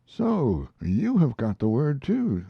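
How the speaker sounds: slow, mildly stirred exclamation; a touch of alarm in the voice; slightly excited. Acted. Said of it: "slightly excited".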